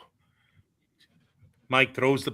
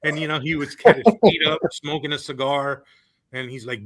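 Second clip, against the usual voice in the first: normal talking voice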